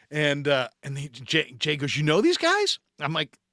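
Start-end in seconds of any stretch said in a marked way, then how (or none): 2.0-2.8 much higher, wide-eyed question; clueless surprise. Retold or imitated. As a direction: ask it completely clueless. Buddy surprised at the recognition.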